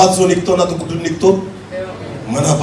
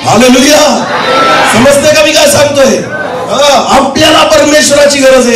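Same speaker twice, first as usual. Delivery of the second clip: raised, insistent sermon cadence; impassioned preaching; 9 semitones higher than usual. Acted. Preacher telling congregation they need God, impassioned preaching. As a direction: drive the point home to the congregation.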